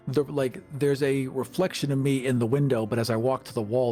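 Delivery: ordinary talk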